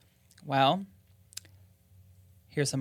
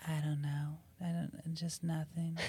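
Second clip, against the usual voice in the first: softer, mumbled ghost reading; soft mumbling ghost; 12 dB quieter than usual. Acted. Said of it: "Or D?".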